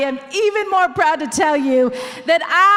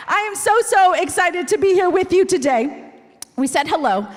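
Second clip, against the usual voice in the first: normal talking voice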